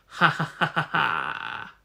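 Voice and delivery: slow otherworldly monster laugh; slow, strange evil cackle. Acted. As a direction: gloat like a feeding demon. Monster gloats over victim.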